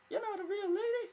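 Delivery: very high, loud squeaky falsetto; bad woman's voice. Mocking someone policing gender, bad female falsetto. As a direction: badly fake a woman's voice, shrieked high. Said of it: "That's a really bad women accent".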